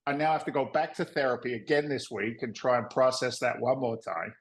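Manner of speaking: ordinary talk, nothing marked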